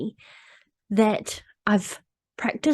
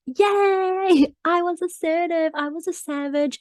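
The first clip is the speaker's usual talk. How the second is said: high, bright cheer of self-congratulation; gleeful cheer; 8 semitones higher, slower than usual. Retold or imitated. She cheers herself for standing up to someone, higher and excited. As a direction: squeal it like a little victory. Celebrating own assertiveness.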